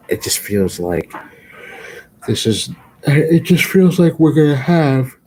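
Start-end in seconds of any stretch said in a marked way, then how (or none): 2.3-5.2 words stretched through a big yawn. Real, not acted. Tired vlogger yawning mid-sentence to viewers. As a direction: talk right through a yawn. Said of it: "sorry for the yawning".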